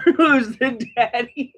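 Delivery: slow, high, laughing who's the daddy; laughing mock question. Teasing the audience about the turkey's father, laughing. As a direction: stretch it out through laughter.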